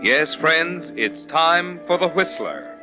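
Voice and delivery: ordinary delivery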